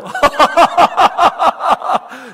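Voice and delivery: big loud laugh, falling away; loud burst of laughter. Real, not acted. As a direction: laugh out loud at your own joke. (크게 터지는 웃음)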